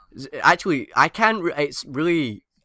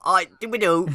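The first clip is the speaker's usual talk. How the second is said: higher put-on Cockney; Cockney accent; 6 semitones higher than usual. Shown as a demonstration. Asked by friend for a Cockney impression, Cockney accent. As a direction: play up a caricature Cockney. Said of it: "can you do a Cockney impression".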